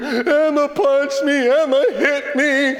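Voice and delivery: slow, high mock wail; whiny, drawn-out bawling; crying child. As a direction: imitate a tearful boy sticking to his story. Son wailing his accusation to Dad.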